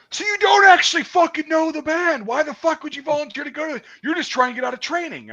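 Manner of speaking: high mocking drill sergeant rant; drill sergeant yelling. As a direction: scold like an angry drill sergeant. Drill sergeant scolding the narrator.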